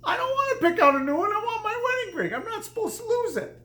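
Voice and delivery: high, wailing sobbed protest; crying. Retold or imitated. Husband sobbing to his wife over the lost ring, high and teary. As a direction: whine it out through tears, pitched high. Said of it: "I started crying".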